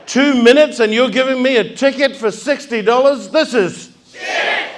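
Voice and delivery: mock outraged rant. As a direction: fume at the ticket. Retold outrage at ticket.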